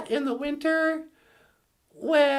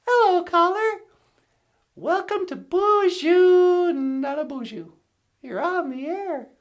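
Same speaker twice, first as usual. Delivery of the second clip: radio host greeting; 3 semitones higher than usual. Acted.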